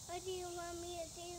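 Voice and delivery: quick, clipped-free robot line; fast robot voice. Pretend. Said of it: "I am a robot".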